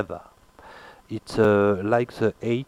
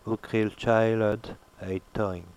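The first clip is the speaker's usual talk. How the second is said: put-on Ukryl child, a toing read slowly word by word; slow demo reading. Shown as a demonstration. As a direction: spell out the sentence slowly. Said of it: "demo sentence read slowly".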